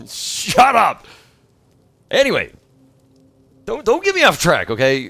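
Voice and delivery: loud, high, laughing protest at chat; laughing shout. Real, not acted. Streamer shouting at teasing chat, laughing. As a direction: yell at chat to stop derailing him, laughing.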